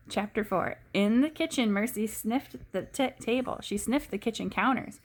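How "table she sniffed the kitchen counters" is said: ordinary delivery, nothing marked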